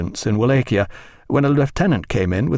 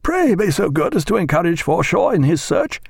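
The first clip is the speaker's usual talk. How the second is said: higher-pitched captain's order, carrying; calling down table; 8 semitones higher than usual. A narrator's reading. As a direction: call a polite order to your lieutenant. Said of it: "called Captain Fellows down the table".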